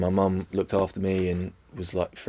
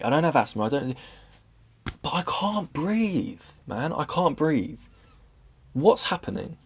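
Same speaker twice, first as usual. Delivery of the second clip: retold panic; 6 semitones higher than usual. Retold panicked thoughts while breathless.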